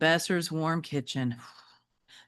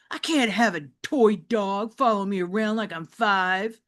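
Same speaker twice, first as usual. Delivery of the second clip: put-on higher, louder annoyed teenager; whiny teen boy; 4 semitones higher, 5 dB louder than usual. James pleading with Mom to take the dog, annoyed teen voice. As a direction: complain like an embarrassed kid.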